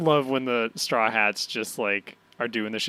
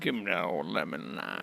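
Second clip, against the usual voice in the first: low, soft put-on voice; low catchphrase voice; 7 semitones lower, 9 dB quieter than usual. Co-host echoing the catchphrase, silly low murmur. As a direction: echo it deep down and softly.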